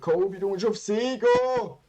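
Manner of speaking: loud high shout at a teammate; yelling. Real, not acted. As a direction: call out urgently.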